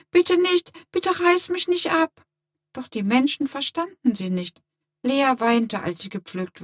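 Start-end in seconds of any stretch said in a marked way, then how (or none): 0.1-2.2 high-pitched urgent begging, starting loud; pleading cry. A narrator's reading. Rose blossom begs humans not to pick it, pleading cry. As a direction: cry out in alarm for mercy. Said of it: "wie eine Rosenblüte schrie".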